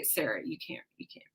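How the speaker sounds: hushed, urgent warning; a worried friend whispering a warning; pleading whisper. Retold or imitated. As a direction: whisper it like begging her to stop. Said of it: "or they say".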